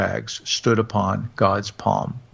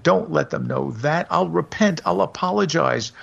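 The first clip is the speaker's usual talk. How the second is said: higher, desperate begging; a terrified sinner begging God for mercy; crying out, begging; 6 semitones higher than usual. A narrator's reading. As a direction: plead with God, voice climbing. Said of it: "cried the wicked man".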